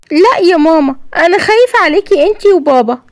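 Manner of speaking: put-on a frightened boy confiding in his mum; scared little boy. Yassin tells his mother he fears for her, childlike and timid.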